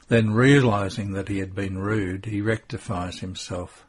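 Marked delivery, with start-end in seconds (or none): none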